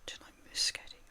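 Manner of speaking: whispered to himself. Real, not acted. Streamer whispering to himself while scrolling chat. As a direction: breathe it under your breath.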